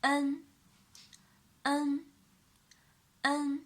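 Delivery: "n" repeated slowly as a model; clear demo syllable. Shown as a demonstration. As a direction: model "n" for the class. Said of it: "a with an alveolar nasal".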